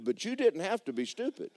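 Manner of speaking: ordinary delivery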